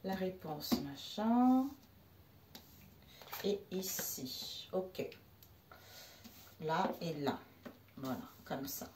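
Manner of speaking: very soft, low murmur; hushed, fragmentary muttering; absent self-talk. Real, not acted. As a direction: talk quietly to yourself while busy laying out cards. Murmuring to herself while laying cards.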